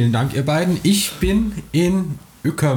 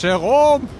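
calling out; 10 semitones higher than usual. Acted. Calling out across the water.

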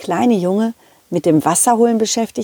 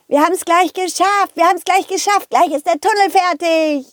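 put-on a touch higher, happy boy call; excited boy calling; 8 semitones higher than usual. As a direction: cheer it like a happy little boy. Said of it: "rief der Junge und er war richtig glücklich".

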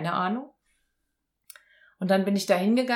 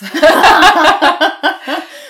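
real burst of laughter, high and loud; a big genuine laugh; 10 semitones higher, 18 dB louder than usual. Real, not acted. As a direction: let the laugh break out freely. Bursts out laughing mid-story.

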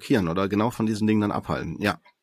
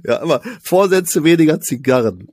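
mock scolding; 5 semitones higher, 4 dB louder than usual. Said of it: "war nur ein Scherz".

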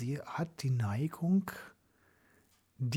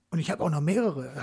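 proudly; 3 semitones higher, 5 dB louder than usual. Retold or imitated. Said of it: "ganz stolz gesagt".

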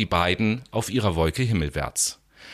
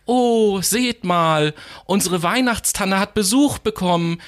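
higher, louder happy call; joyful child calling; 9 semitones higher, 3 dB louder than usual. Acted. Child spots the star on the tree, calling out high and happily. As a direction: call out in delight to everyone. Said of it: "eine Kinderstimme fröhlich rufen".

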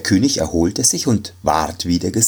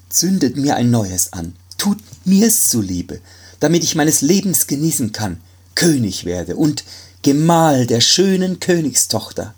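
high, fast, frightened begging; frightened pleading; 7 semitones higher than usual. Acted. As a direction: beg desperately for your life. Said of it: "sagte der erschrockene Arzt". (erschrocken flehend, hoch und schnell)

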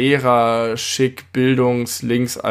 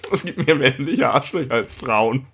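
amused, teasing quote; amused echo. Retold or imitated. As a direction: repeat it back, half laughing.

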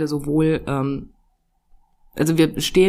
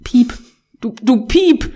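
bleep censor; 5 semitones higher, 5 dB louder, slower than usual. Retold or imitated. Voicing a censor bleep over swearing.

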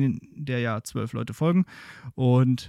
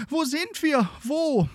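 wailing; 14 semitones higher, slower than usual. Acted. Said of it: "ein Jammern wird laut".

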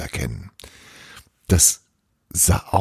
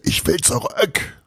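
put-on high urgent child's cry; insisting child; boy's bellow; 8 semitones higher than usual. A narrator's reading. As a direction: grab the sleeve and cry out. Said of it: "brüllte".

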